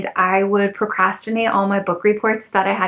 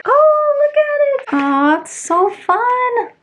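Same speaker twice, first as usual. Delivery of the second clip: high, loud squeal of delight over the record; gushing delight; 4 dB louder, slower than usual. Real, not acted. Unboxing for her viewers, squealing with delight. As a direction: squeal with joy, stretching out the last words.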